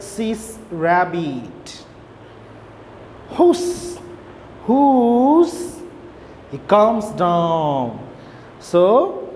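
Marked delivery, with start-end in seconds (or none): 3.3-5.8 unvoiced high whoosh, then a voiced one; whooshing wind sound. Teacher reading to class, imitating the gusting wind.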